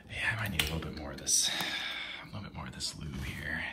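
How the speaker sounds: fast hushed aside; whisper. Real, not acted. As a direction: rattle it off in a whisper.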